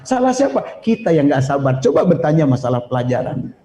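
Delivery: ordinary talk, nothing marked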